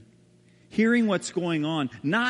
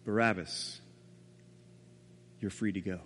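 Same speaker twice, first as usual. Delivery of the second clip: guard's quiet words; 7 semitones lower, 8 dB quieter than usual. Retold or imitated. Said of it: "and said".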